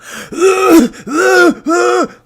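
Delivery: sharp high gasp; loud gasp. Real, not acted. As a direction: gasp in alarm. Alarmed at the cough.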